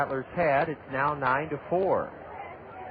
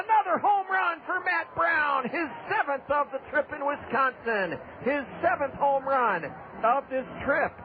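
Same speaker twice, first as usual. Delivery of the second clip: excited home run call; 7 semitones higher than usual. Real, not acted. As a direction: voice soaring high, shouting the homer.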